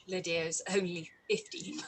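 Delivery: whispered through a giggle; a breathy, laughing aside; giggled whisper. Acted. Whispering and laughing to Wickham about Lydia.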